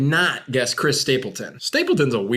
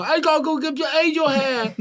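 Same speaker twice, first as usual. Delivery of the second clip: put-on very high kid voice, laughing through it; little-kid voice; 13 semitones higher than usual. Child answering mom at dinner, very high and giggly. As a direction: squeak it like a small child. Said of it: "And you go".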